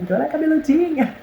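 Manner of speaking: high laughing delight. Real, not acted. Mother describing her newborn's hair, laughing. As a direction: laugh through the words, charmed.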